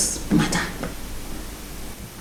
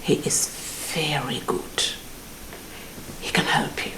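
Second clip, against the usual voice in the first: whispered secret; 3 semitones lower, 16 dB quieter than usual. Acted. Secret suggestion of juju man.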